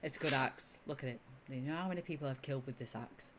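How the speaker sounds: low, quiet, casual bragging; rambling show-off. Acted. Ro showing off her axe to Durham, low quiet rambling. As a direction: ramble softly and proudly about it. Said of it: "rambling on about the axe".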